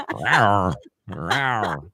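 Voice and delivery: ordinary talk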